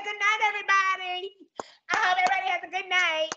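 high, sing-song good night; mock sweet farewell. Cashier leaving coworkers, in a sing-song voice. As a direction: cheerfully rub it in.

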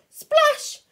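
very high, loud Splash; shouted splash. Shown as a demonstration. Teacher showing the child how to read Splash, loud high shout. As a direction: shout Splash with the exclamation mark. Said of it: "so we have to shout".

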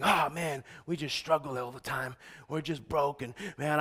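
low, quiet, downcast imitation; sunken, soft mock-weary voice; mock defeated complaint. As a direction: act out a beaten-down man grumbling. Imitates a man complaining of struggles.

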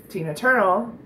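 ordinary talk

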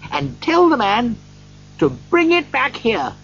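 high, excited voice calling out; excited Scrooge. A narrator's reading. Scrooge promises the boy shillings to hurry back, excited. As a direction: urge the boy on, high and insistent.

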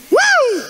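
very high "woo"; excited whoop. Real, not acted. Preacher feeling the anointing before congregation, high whoop.

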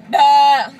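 loud bleating goat noise. Retold or imitated. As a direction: bleat like a goat, full volume. Repeating her goat bleat.

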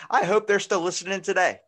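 loud, high shout-out; calling out. Real, not acted. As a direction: holler it to listeners out there. Calling out to listeners, joking.

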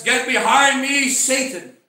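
loud, high stern rebuke; preacher voicing an angry rebuke. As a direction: thunder it out as a sharp command. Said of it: "When he was angry".

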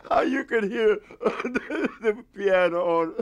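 high, slowed by laughing; real laughter. Real, not acted. Explaining the loud piano to interviewer, laughing. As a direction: push the words out between laughs. Still laughing over the story.

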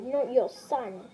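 ordinary talk, nothing marked

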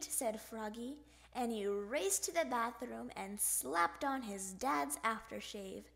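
ordinary delivery, nothing marked

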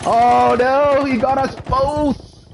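high, slow stunned dismay; shocked cry. Real, not acted. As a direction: cry out in disbelief. Both killed again, shocked.

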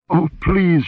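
high-pitched, abject plea; frightened begging. A narrator's reading. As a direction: beg in fright. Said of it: "she begged, quivering with abject fright".

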